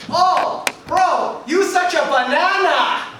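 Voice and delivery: loud, high teasing bro voice; lunch-table friend mocking him as a banana; mocking taunt.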